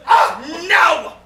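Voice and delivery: loud high cry of no; horrified shout. Acted. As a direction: yell it in horror. Horrified outburst at proposal.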